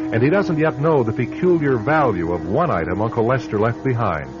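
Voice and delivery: usual speaking voice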